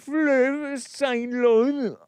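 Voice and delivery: high, loud, hammed-up French; over-the-top French pronunciation, very drawn out; mock French accent. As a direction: stretch it slowly in exaggerated French. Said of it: "in a French accent".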